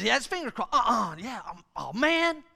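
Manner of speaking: hammed-up kid losing the argument; conceding kid. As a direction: give in like a duped child. Acting out gullible kid conceding.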